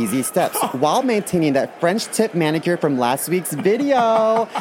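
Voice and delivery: bubbly high influencer vlog voice; chirpy sponsored-video host. Pretend. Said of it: "something like this".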